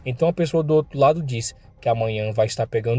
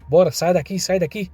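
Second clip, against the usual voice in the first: ordering everyone out; 6 semitones higher than usual. Acted. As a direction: shove them out with your voice. Valentões estão mandando todo mundo sair.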